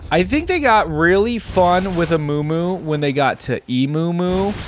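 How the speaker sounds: usual speaking voice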